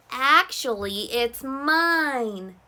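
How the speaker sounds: loud goose voice; honking goose voice. Acted. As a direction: honk the line like a goose. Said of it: "honked Goose".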